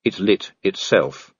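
ordinary talk, nothing marked

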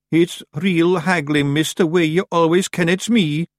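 high, drawn-out Scots creature voice; high-pitched Scottish grumble; sheepish Scottish Feegle. A narrator's reading. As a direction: complain as a little Scottish pixie caught out.

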